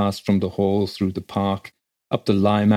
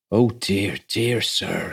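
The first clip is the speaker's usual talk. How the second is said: dismayed country clerk, understated; shocked; much slower than usual. A narrator's reading. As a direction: lament it under a touch of shock. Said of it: "yet more shocked".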